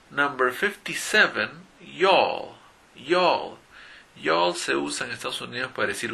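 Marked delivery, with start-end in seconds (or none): 1.8-2.6 y'all said jol, loud falling; Southern y'all as one syllable; model pronunciation. Shown as a demonstration. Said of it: "se pronuncia y'all". 2.9-3.6 y'all said jol, one syllable; teacher model of y'all; y'all, jol falling; model pronunciation. Shown as a demonstration. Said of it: "se pronuncia y'all".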